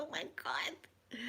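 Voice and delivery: slow breathless whisper of disbelief. Retold or imitated. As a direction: whisper it in awe. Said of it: "And I was like".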